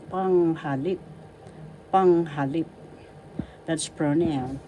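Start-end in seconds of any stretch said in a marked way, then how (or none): 0.1-2.6 "Panghalip" with strong PANG and LIP; accent on first and third. Shown as a demonstration. Teacher repeating "Panghalip" for learners, first and third syllables stressed. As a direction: stress both ends of "Panghalip". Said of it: "and the third has the accent".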